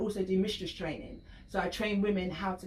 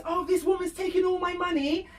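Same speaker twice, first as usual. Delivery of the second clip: high, loud mock complaint; calling for police; 10 semitones higher, 6 dB louder than usual. Client reporting the dominatrix to a passing policeman, loudly aggrieved. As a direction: imitate an aggrieved man shouting for help. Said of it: "he calls the police officer over".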